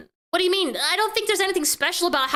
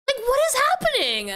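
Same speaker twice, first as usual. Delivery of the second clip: high-pitched, baffled outburst; bewildered; 7 semitones higher than usual. Real, not acted. Streamer reacting to the European girls pattern, bewildered. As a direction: exclaim in total confusion.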